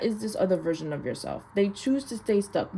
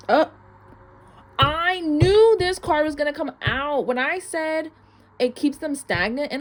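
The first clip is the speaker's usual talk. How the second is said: excited; 8 semitones higher, 4 dB louder than usual. Real, not acted. Card she expected comes out.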